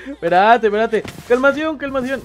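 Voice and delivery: high, loud, rushed pleading; frantic. Pretend. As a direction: try to calm yourself in a panic. Panicking under attack. (pánico agitado)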